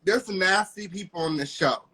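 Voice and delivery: ordinary talk, nothing marked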